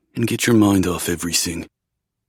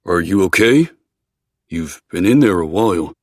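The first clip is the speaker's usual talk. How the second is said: calling through door; 6 dB louder than usual. Acted. Asking through the bathroom door.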